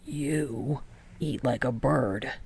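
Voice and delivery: low, slow, understated; Kageyama's retort. A narrator's reading.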